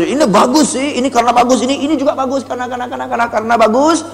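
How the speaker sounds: high, repetitive sales gush; overpraising. Shown as a demonstration. Salesperson praising product after product, gushing. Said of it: "terlalu nyanyiin kehebatannya".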